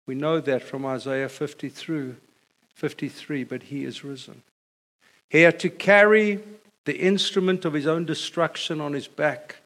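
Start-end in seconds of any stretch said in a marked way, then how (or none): 3.4-4.4 softer, lower: but he is risen; quiet refrain. Acted. Preacher answering each suffering of Christ, soft and low. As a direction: let the answer fall soft and sure.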